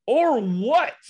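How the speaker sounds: high, loud confrontational comeback; retold angry challenge. Coach swings round at referee, retold angry challenge. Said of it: "I swung around and went".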